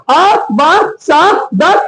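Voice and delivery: "अ ब स द" slowly, one sound at a time; separate, measured letter sounds "अ ब स द"; phonic alphabet. Shown as a demonstration. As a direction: demonstrate reading letters as "अ ब स द". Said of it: "इस प्रकार से ये पढ़ना".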